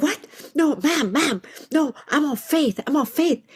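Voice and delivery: lightly voiced irritated agent; a hint of a curt phone agent; irritated supervisor.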